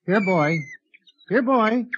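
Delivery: tentative, rising pet call. Acted. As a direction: call a little creature like a dog. Coaxing a creature like a dog.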